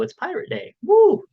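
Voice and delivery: high, loud whoop of joy; excited cheer. Real, not acted. Cheering for Pirate Day.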